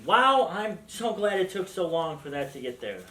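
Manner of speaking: normal talking voice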